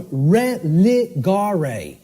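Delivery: "religare" very slowly, as reh-lee-GAR-ay; "religare" in slow separate syllables; sounded-out Latin. Shown as a demonstration. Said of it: "R E L I".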